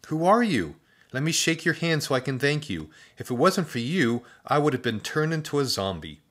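put-on breathless eager boy; grateful boy. A narrator's reading. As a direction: pour out thanks in a boy's voice. Boy addresses rescuer.

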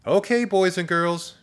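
high, loud classroom singsong; sweet teacher. A narrator's reading. Teacher calls class to counting, sugary and high. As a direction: address small children, sweet and high. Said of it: "Her voice sounded like a warm slice of chocolate cake".

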